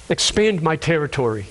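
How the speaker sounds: low, measured prayer; restrained, reverent petition; sincere request. Acted. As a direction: ask humbly, pitch dropped. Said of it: "this isn't the man's cry".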